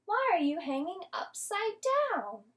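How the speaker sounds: loud curious bat voice. A narrator's reading. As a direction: ask it loudly from a branch. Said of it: "a loud voice said".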